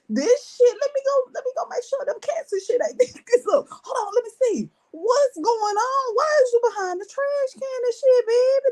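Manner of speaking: high, laughing, incredulous; laughing disbelief. Real, not acted. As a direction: crack up in disbelief.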